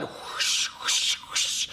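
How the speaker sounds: repeated airy whoosh noises; whispered whooshes. A narrator's reading. Narrator imitating problems flooding out, in breathy whooshes. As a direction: imitate rushing wind without voice.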